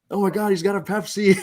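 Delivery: high-pitched, mock-amazed exclamation; excited fan imitation. Fans high-fiving him at his seat, mock excited.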